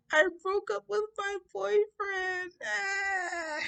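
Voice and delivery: slow, high-pitched mock wail; bawling breakdown. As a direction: sob out the confession, hammed-up. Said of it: "having my breakdown".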